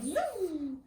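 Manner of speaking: ordinary delivery, nothing marked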